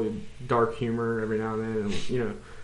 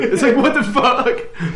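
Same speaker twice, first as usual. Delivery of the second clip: laughing; 9 semitones higher, 4 dB louder, slower than usual. Real, not acted. Laughing at clumsy monster.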